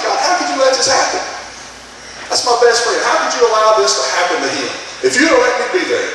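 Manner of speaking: high-pitched, pleading protest to God; angry questioning. Retold or imitated. Young man questioning God about his best friend, high and angry. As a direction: voice lifted high in retold anger. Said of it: "the young man became angry".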